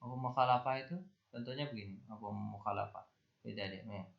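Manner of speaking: normal talking voice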